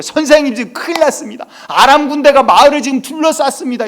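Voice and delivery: loud, high-pitched panicked report; frantic alarm. Retold or imitated. Servant reports the Aramean army to Elisha, frantic alarm. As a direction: act out a servant shouting urgent news. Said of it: "이러고 이제 난리가 난 거예요".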